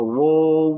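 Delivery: ওউ as a long loud "wo-u", o in place of aa; common mispronunciation. Shown as a demonstration. Teacher mimics how many say it, mispronounced. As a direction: stretch ওউ with an o vowel. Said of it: "এক একজনের এক এক রকম উচ্চারণ".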